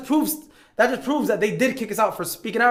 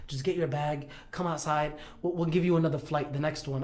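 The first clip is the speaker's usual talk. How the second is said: softer, lowered official voice; retold low-key order; 3 semitones lower, 7 dB quieter than usual. Captain asking them to come outside, soft and low-key. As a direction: imitate someone calmly removing a passenger.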